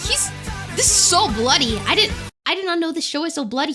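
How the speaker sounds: ordinary delivery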